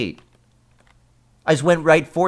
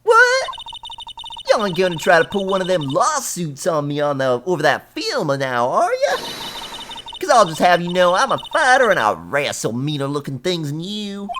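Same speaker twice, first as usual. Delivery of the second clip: high, loud Southern country voice, squealing up on questions; feisty Southern fighter; 5 semitones higher, 4 dB louder than usual. Acted. Southern woman warning lawyers off a lawsuit, feisty. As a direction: warn them off in a scrappy Southern twang.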